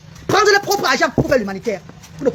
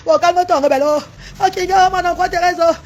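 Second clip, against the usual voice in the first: slow, sustained keening; wailing plea; 2 semitones higher than usual. Real, not acted. Woman begging relatives to plead with her brother, wailing. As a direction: beg through a drawn-out lament. Begging pardon, told to calm down.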